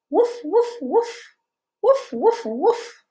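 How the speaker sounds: high playful puppy barks; puppy woof. Shown as a demonstration. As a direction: bark like a little puppy. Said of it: "what sound does a doggy make".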